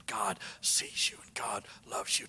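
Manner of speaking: soft whisper, slowing down; whispered reassurance. Real, not acted. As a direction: whisper it like a secret promise. Intimate reassurance to congregation.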